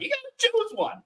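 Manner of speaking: very high cartoon gun voice; squeaky little cartoon gun; talking gun voice. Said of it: "he's like".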